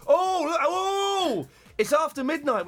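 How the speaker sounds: high excited shout; excited exclamation. Real, not acted. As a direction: burst out with excitement. Host excitedly announcing midnight.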